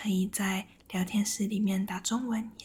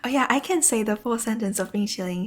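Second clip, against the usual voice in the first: usual speaking voice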